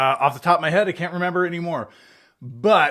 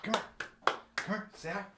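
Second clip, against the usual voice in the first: pet talk; 7 semitones higher, 12 dB quieter, faster than usual. Real, not acted. Coaxing the dog to greet.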